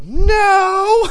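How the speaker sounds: sudden high-pitched loud cry from the balcony; mock girl yelling no from the balcony; girl's shout of no. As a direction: yell it like an offended drunk girl in the crowd. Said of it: "one girl voice just go".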